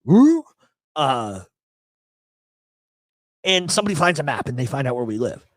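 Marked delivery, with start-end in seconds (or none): 0.1-0.4 high, loud cartoon hoot; Scooby-Doo style "ooh". Joking that the botched robbery is a cartoon episode, mock cartoon "ooh". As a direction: throw in a startled cartoon-dog noise. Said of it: "sounds like a fucking Scooby-Doo episode".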